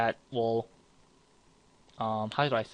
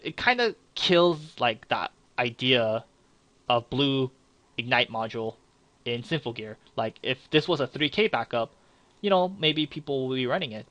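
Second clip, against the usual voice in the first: ordinary talk, nothing marked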